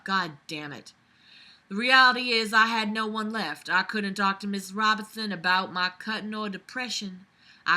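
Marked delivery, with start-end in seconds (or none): none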